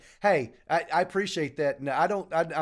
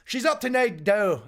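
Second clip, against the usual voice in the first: Scottish accent; 6 semitones higher, 4 dB louder than usual. Shown as a demonstration. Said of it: "the Daily Scottish saying".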